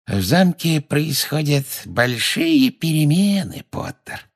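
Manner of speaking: slow, high, drawn-out gloat; gloating caretaker. A narrator's reading. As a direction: savour each word, dropping away on the name. Gloating caretaker talks to Potter. (злорадный смотритель, нараспев и медленно)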